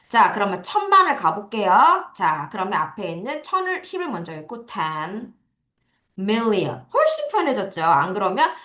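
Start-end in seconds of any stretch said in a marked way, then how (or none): none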